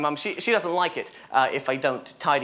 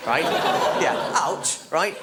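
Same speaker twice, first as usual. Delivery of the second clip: ordinary talk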